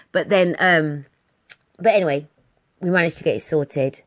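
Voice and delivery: ordinary delivery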